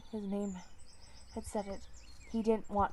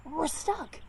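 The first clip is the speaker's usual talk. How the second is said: higher, alarmed cry; panicked cry; 7 semitones higher, 4 dB louder than usual. Acted. Trapped cat crying out as the door slams. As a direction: blurt it in sudden panic.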